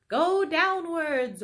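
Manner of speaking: high, loud slow command; order. Acted. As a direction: bark the order to dig. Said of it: "ordered Mister Fox".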